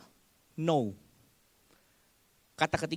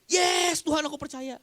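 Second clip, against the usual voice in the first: high, loud yes Lord; hammed-up shouted faith; mock shouting believer; 10 semitones higher, 5 dB louder, slower than usual. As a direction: yell it like a zealous congregant. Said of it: "jangan teriak-teriak".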